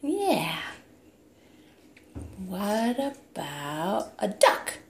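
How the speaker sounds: put-on playful sing-song toddler talk; reading to a toddler. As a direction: coax the toddler with animal sounds. Animal sounds game with toddler.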